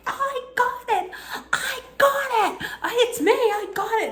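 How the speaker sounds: very high, laughing cries of joy; excited yelling. Retold or imitated. Fan shouting to the stands that she caught the ball, laughing high. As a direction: shriek it through laughter, overjoyed. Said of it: "And I'm yelling".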